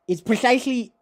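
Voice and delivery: put-on high, loud lisped accent; emphatic philosopher caricature; Zizek. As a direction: hiss "precisely" through a forced lisp. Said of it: "it's hard to do though".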